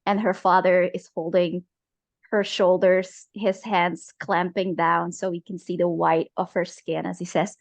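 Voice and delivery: ordinary talk, nothing marked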